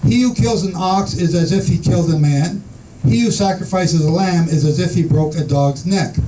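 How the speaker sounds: usual speaking voice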